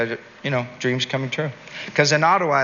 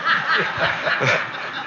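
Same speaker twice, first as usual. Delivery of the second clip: high unvoiced giggle; 13 semitones higher than usual. Real, not acted. Laughs at own stalking joke.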